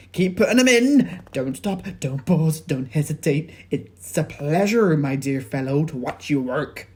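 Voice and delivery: high, loud, gleeful urging; excited Mister Cranky. A narrator's reading. Mister Cranky cheers George on, high and loud. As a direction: cheer the boy on, thrilled. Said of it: "cried Mister Cranky".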